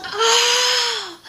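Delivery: high mock scream of pain; retold pained scream. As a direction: imitate a long high-pitched wail of pain. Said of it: "Just screaming in pain".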